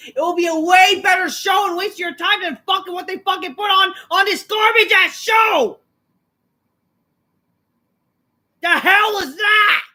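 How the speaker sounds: yelled high and loud, slowing at the end; furious shout. Real, not acted. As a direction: blow up at the show in full anger. Rant building to yelling.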